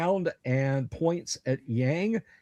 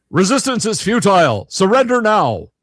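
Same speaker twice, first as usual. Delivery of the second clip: loud robotic machine voice, growled; metallic growl; 7 semitones higher, 12 dB louder than usual. Acted. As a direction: bark it like a menacing machine. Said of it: "the metallic voice, uh, growls out".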